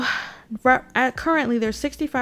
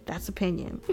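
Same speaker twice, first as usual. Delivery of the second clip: much softer, under-the-breath repeat of "That's opinion"; quiet aside; 3 semitones lower, 7 dB quieter than usual. Shown as a demonstration. To viewers after the rant, softer joking aside. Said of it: "But hey".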